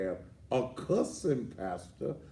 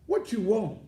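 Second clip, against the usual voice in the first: annoyed shout; 10 semitones higher, 5 dB louder than usual. Real, not acted. Answering phone irritated.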